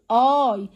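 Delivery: اي held as a slow "eye"; clear long I. Shown as a demonstration. Teacher demonstrating the sound of I, drawn out. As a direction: let اي ring out long and clear. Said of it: "ظهر صوت واضح".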